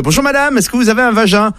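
high-pitched, put-on caller's voice; odd doorstep stranger. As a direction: play the strange visitor, pitched well up. Same stranger returns, put-on voice. (voix aiguë de visiteur bizarre)

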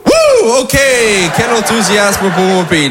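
exaggerated crowd-pleasing whoop; mock enthusiasm. Reacting to audience's cheer for USA.

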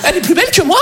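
high shriek into indignant rant; exaggerated outraged girlfriend. Girlfriend told Pauline is prettier, exaggerated outraged shrieking. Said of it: "J'exagère un tout petit peu".

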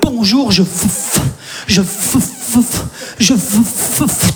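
stuck stammering on the f sound; deliberate comic stutter; fake stutter. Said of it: "faire semblant de bégayer".

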